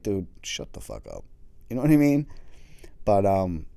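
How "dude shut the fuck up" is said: much lower, unimpressed brush-off; heroin addict. Retold or imitated. Addict dismissing the therapist, low and blunt. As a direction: drop low and shut the therapist down. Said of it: "he's like".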